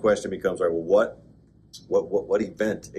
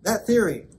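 usual speaking voice

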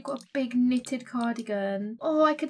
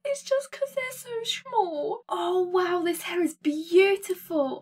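much higher, slow cooing voice after a laugh; 7 semitones higher, slower than usual. Real, not acted. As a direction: coo like at something tiny. Cooing at tiny details.

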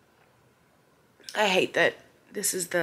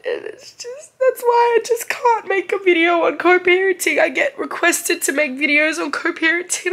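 teary, high, breaking voice; struggling through tears; real crying; 8 semitones higher than usual. Real, not acted. Single mom crying about co-parenting, teary high voice. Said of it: "I'm gonna wipe these tears".

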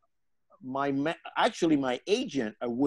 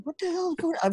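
much higher, fast mock bewilderment; bewildered; 4 dB quieter, much faster than usual. Ignored in the agency meeting, high baffled outburst.

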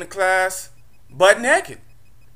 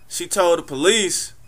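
ordinary talk

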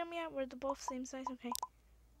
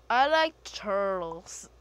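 slow, loud, stretched-out meme voice; meme quote; 2 semitones lower, 12 dB louder, much slower than usual. Pretend. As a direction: bellow it slowly like the turtle kid. Meme line shouted slowly.

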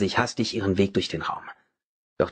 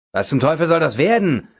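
louder, high, gruff question; furious challenge; angry demand; 6 semitones higher, 5 dB louder than usual. A narrator's reading. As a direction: confront him while gripping his arm. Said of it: "fragte er wütend".

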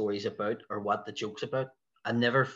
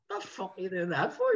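imitated indignant heckling; 8 semitones higher than usual. Hecklers object to him speaking Irish.

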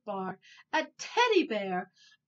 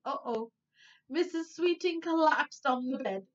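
ordinary delivery, nothing marked

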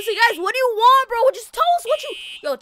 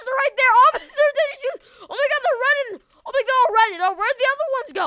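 high, excited urgent calling; game panic; 4 semitones higher, 4 dB louder than usual. Pretend. Player urging friends to follow them out, panicky. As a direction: call out frantically to the others in the chase. Fleeing the fire with the crowd.

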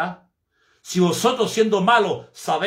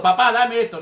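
put-on loud, bratty child's demand, much higher; insolent shouted demand; 6 semitones higher, 4 dB louder than usual. Preacher imitating a child rudely shouting at his father. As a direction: yell it like a spoiled kid demanding. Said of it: "de una manera como gritando".

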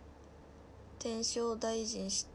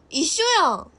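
very loud, high-pitched exclamation; surprised outburst; 6 semitones higher, 15 dB louder than usual. Real, not acted. Streamer spotting two look-alike names, loud surprised outburst. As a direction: burst out in sudden protest.

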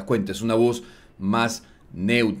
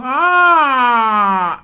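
loud, high held "aah"; projected goal cry; 12 semitones higher, 11 dB louder than usual. Shown as a demonstration. Demonstrating the commentator's technique, projected goal cry. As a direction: belt a sustained, placed commentator wail. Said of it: "está impostando la voz".

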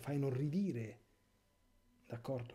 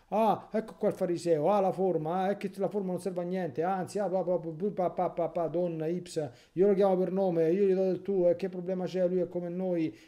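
higher, louder sneering parody; mocking modernists; 5 semitones higher, 7 dB louder than usual. Priest parodies the modernists, loud and sneering. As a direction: caricature the modernists with scorn.

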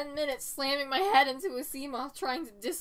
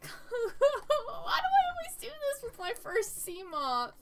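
high whine; 11 semitones higher than usual. Real, not acted. Whining over lost ship.

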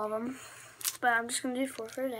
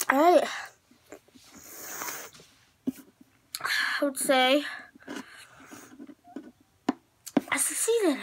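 triumphant; 4 semitones higher, 4 dB louder than usual. Real, not acted. After sour candy ordeal.

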